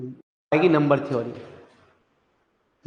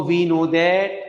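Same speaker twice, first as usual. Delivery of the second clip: drawn-out words, rising at the end; slow teacher's dictation; much slower than usual. Real, not acted. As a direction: dictate slowly, stretching the last word. Dictating for students to write.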